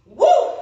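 cheering whoop; high loud whoop. Real, not acted. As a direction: let out a pumped whoop. Coach cheering mid-set.

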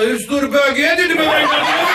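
high, loud, laughing mockery; mocking dad shout. Dad mocking his son about the closet, loud laughing shout. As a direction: yell the jab up high through laughter.

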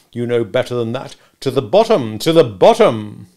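loud, high, impatient scolding; hectoring lawyer; bullying cross-examiner. Acted. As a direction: override every excuse. Stranger cross-examining Mister Wopsle.

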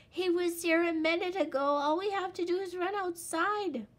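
high, loud, fast urging; urgent cry. A narrator's reading. Little Wolf to his father, fast urgent cry. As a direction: rush them out the door. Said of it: "cried Little Wolf".

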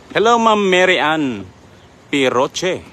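ordinary talk, nothing marked

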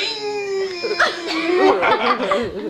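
high squealing laughter; shrieking laugh. Real, not acted. After bounce.

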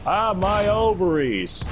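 slow, hammed-up wail; mock pained cry. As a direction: ham up a pained moan. Comic pain cry.

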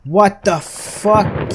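loud, high, drawn-out alarm; shocked shout. Real, not acted. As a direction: stretch the shout out in disbelief. Second jump scare in game.

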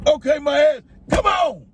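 sharp, high, furious outburst; angry shout. Real, not acted. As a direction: snap back in a rage. Angry retort in car.

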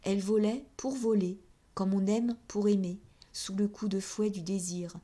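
usual speaking voice

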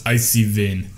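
co-host's mock Russian nurse; Russian accent. Co-host helping out the storyteller, Russian nurse accent. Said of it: "Ah, yes, exactly".

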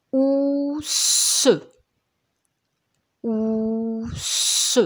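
"Ousse" with a long held vowel, then a strong hiss; "Ousse" slowly sounded out as ouuu-sssse; stretched syllable. Shown as a demonstration. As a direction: draw out "Ousse", sounding a final schwa.

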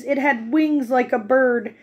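ordinary delivery

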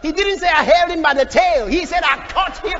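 loud, shouted pulpit delivery; impassioned preaching. Real, not acted. Preacher retelling David's fight with the lion, impassioned shout. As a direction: hammer the story home at full force.

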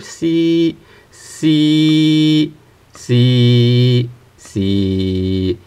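each "C" held level, stepping down in pitch; flat tones. Shown as a demonstration. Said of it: "you have the constant pitch".

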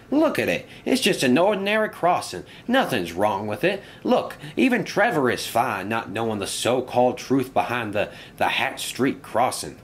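high-pitched start, easing toward normal pitch; scoffing engine. Acted. Engine at the crossing scoffing at the curse, animated and dismissive. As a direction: insist it's nothing, a touch exasperated.

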